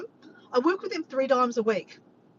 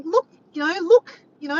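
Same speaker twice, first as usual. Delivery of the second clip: loud, higher retold yell; yelling back down; 7 semitones higher, 9 dB louder than usual. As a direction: call it down loudly, reenacting an argument. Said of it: "I'm yelling back down".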